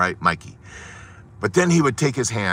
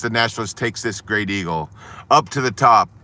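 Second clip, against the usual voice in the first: ordinary talk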